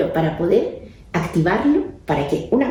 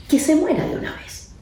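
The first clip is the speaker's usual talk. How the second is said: furious outburst; 11 semitones higher than usual. Retold or imitated. Son repeats the wish, louder.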